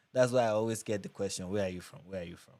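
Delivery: ordinary talk, nothing marked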